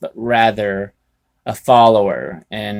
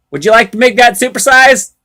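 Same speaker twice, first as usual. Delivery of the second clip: mock cashier; 8 semitones higher, 8 dB louder than usual. Mock drive-thru cashier voice.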